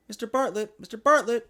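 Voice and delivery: growing call for attention. A narrator's reading. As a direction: call his name to catch him. Someone calling his name.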